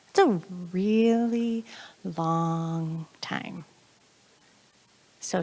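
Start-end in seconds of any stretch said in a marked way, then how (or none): 0.1-3.6 slow, stretched-out words for emphasis; drawn out. Real, not acted. Speaker showing how long the delay feels, drawn out.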